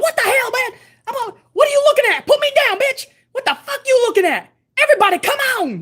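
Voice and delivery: high, fast, squeaky cartoon bunny voice; Kevin Hart as Snowball. Doing Kevin Hart's bunny Snowball to the chat, high squeaky voice. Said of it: "He's like".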